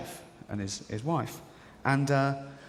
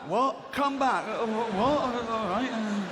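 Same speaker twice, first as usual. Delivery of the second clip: high, hesitant and baffled; confused builder; 9 semitones higher, slower than usual. Retold or imitated. Builder called back for an encore, high baffled stammer.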